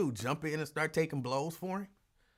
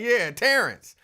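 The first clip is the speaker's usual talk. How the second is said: usual speaking voice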